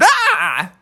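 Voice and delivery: very high bear cub shriek; startled yelp. Acted. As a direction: jump back with a squeal. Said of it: "And Bubby said".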